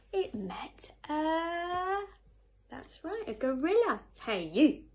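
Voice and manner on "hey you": loud, pushy call; bad-tempered hail. A narrator's reading. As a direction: hail them loudly, looking for trouble. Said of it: "said the bad-tempered ladybird".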